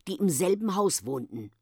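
plain reading voice

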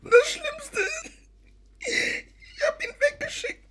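high, slow, tearful sob; weeping confession; crying. Acted. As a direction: cry the words out in guilt. Guilty confession of sending him away.

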